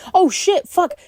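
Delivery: high shocked outburst. Real, not acted. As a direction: blurt it in sudden alarm. Realizing roommate is missing.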